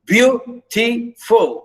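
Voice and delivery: deliberate teacher's count of "beautiful"; syllable by syllable. Shown as a demonstration. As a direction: break "beautiful" into beau, ti, ful. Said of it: "stress the first".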